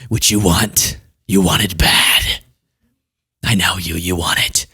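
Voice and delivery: low, slow creepy voice. Pretend. Host pushing viewers to subscribe, in a creepy low voice. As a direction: lean in and make it sound creepy. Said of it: "So that sounds creepy".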